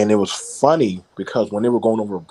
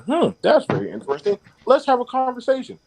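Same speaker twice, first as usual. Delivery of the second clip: high mock intrigue; 13 semitones higher, 3 dB louder than usual. Retold reaction to sheik's remark.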